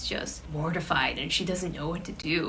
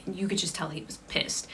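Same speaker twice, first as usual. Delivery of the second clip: usual speaking voice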